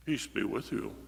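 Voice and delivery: low, soft, sanitized blessing; mock solemn Jesus. Preacher contrasting a tame blessing, low and soft. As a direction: drop it down, pious and hushed. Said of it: "much more crazy and much more noisy than just".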